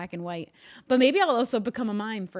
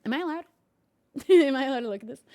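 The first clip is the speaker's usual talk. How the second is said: usual speaking voice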